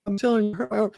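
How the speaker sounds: put-on high kid's voice, pleading; son's complaint. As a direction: whine like a hurt little boy. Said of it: "He's like".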